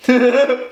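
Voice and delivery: sudden high giggle; high burst of laughter. Real, not acted. Vlogger cracking up at his own joke, high laugh. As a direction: squeal a quick laugh.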